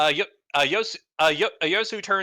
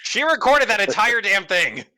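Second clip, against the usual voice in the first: louder and higher, real exasperation; exasperated; 6 semitones higher, 6 dB louder than usual. Real, not acted. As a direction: vent at the phone. Exasperated at siri.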